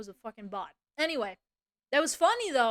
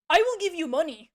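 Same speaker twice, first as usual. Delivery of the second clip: loud, high, stilted mimicry; mocking quote; 5 semitones higher, 8 dB louder than usual. Streamer mimicking scammer's money offer, mocking. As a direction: parrot it back in ridicule. Mocks the scammer's chat message.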